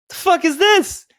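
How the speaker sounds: high-pitched, incredulous outburst; re-enacting a baffled, startled demand; shocked disbelief. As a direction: voice leaps way up in alarmed confusion. Shock at finding bullet hole.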